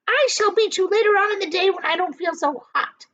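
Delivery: high, loud scolding hag; angry aunt's cry; Aunt Spiker scolding. A narrator's reading. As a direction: berate the lazy boy. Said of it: "she said".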